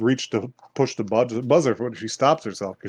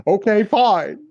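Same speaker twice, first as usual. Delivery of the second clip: exasperated; 9 semitones higher, 4 dB louder, slower than usual. Retold or imitated. Girl giving in.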